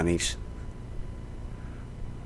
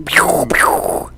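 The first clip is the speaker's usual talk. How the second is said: very high unvoiced "pew pew"; laser sound effect. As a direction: zap it like an arcade laser. Imitating Space Invaders laser sounds.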